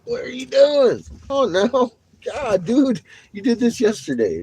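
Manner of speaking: high, loud, slow scold at the dog. Real, not acted. Scolding the dog on his feet, high and loud. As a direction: tell the pet off in a high voice.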